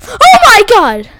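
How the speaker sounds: loud, high cry falling away; startled scream. Real, not acted. Player surprised by a monster, startled scream. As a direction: yell out in fright. Jump scare.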